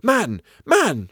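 high pleading cry. Acted. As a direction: cry out to him in alarm. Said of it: "she cried".

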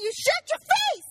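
high, loud, slow playful shout; hammed-up outrage at a friend; mock angry yell. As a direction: fake fury at being teased.

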